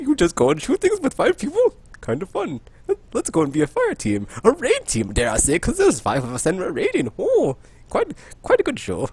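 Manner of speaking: high, mock-posh professor accent; jolly hammed-up British professor accent; science professor accent. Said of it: "science professor voice of like".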